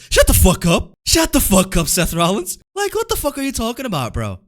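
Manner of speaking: loud, furious yelling at him; furious shout. Real, not acted. Yelling at Seth Rollins over his complaint, a furious shout. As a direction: blast him with a real angry shout.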